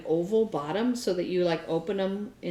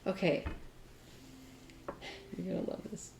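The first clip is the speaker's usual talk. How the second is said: under his breath; 13 dB quieter, faster than usual. Retold or imitated. Clerk mutters to himself before ringing up, under his breath. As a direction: quietly warn them bad news is coming. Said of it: "under his breath going".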